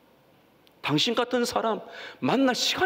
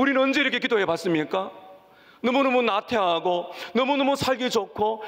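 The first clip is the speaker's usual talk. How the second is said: usual speaking voice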